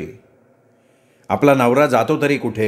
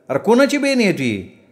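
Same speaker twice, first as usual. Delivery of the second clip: high-pitched indignant challenge; angry challenge; 8 semitones higher than usual. A narrator's reading. Mother confronting the dog snatchers, indignant. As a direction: challenge the intruders, pitch raised.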